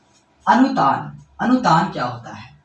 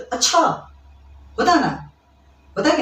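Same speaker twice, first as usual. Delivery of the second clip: surprised; 8 semitones higher than usual. Shown as a demonstration. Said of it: "जैसे surprise हो गया किसी चीज को".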